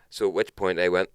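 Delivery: ordinary talk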